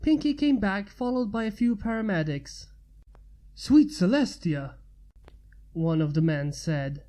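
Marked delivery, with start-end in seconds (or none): 3.5-4.7 louder, shocked cry; alarmed exclamation. A narrator's reading. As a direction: react to the wounded. Paramedic exclaims.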